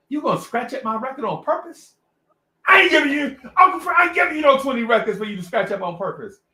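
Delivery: loud, high-pitched indignant refusal; mock outrage. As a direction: act out an appalled record man. Record man refuses to give records.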